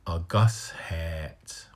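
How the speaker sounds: A Gus hat with a long hat and a stop; slow demo. Shown as a demonstration. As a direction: slowly model A Gus hat. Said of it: "then you got a glottal stop".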